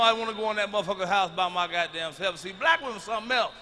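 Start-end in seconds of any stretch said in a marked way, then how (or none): none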